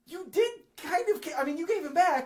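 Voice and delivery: high-pitched indignant parent act; protesting parent. Acted. Parent objecting to a staged kidnapping, high-pitched protest.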